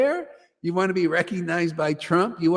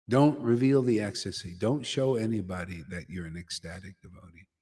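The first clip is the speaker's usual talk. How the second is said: low, cautious teacher imitation, fading softer; hushed warning; 9 semitones lower, 7 dB quieter than usual. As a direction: warn them under your voice, as if secret. Teachers warn to hide ecstasy.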